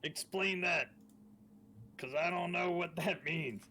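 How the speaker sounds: loud, high in-character demand; shouting back. Acted. Player asking Casimir to explain, shouting back. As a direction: yell for an explanation.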